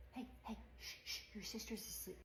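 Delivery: urgent whispered shushing; hushed whisper. Acted. Mom whispers to toddler so the sleeping baby stays asleep, hushed. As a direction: hush him without waking the baby.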